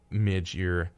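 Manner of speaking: ordinary delivery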